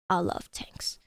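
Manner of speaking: low unvoiced whisper. Whispering again to friends about tanks. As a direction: breathe it like a secret.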